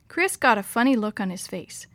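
usual speaking voice

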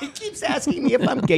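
high, laughing mock yell; mock shout. Retelling the coworker's complaint, high laughing shout. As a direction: blurt it out in a high, amused yell.

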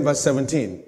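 ordinary talk

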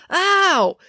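high, acted "ow"; pained yelp. Joe stamped on the head by Finn, pained yelp.

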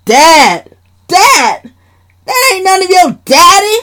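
high, loud yell. Acted. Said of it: "Sheila yelled".

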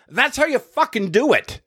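a hint of smug triumph, pitched high; smug retort. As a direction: tell the mother off with a smug edge. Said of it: "I said to her".

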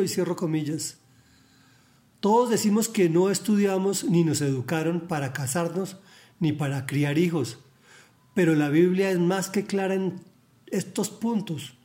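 ordinary delivery

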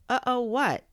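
high, loud exasperated echo; fed-up demand to know. Acted. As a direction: throw the uh-oh back impatiently. Boy exasperated by toy.

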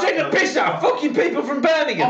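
high, shouted indignation; mock outrage. Real, not acted. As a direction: cry out in mock offence. Mock outrage at Brummie impression.

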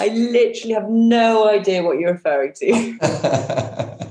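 slow, deadpan teasing denial; feigned ignorance. Pretend. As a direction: pretend innocence with a grin. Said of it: "playful feigned ignorance".

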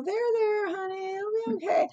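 put-on hushed, quick comfort for a child; soothing mom. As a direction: softly soothe a crying daughter. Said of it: "just patting her on the back".

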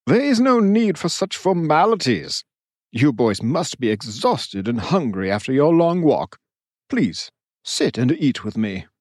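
a lightly voiced hearty lord, welcoming; host greeting weary guests warmly; genial ruler.

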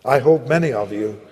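ordinary delivery

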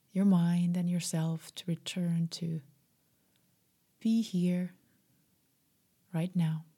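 plain reading voice

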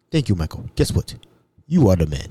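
low, imitated African voice; grateful African man, voiced low; African accent. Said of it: "Mbutu was an African".

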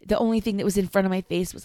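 ordinary delivery

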